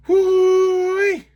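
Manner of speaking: high, loud "whoo-wee"; gleeful whoop. Real, not acted. Recovering from laughing at Scotty, high loud whoop. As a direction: let out a long high whoop.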